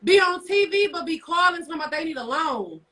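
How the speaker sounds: ordinary talk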